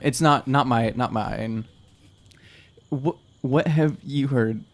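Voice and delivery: ordinary talk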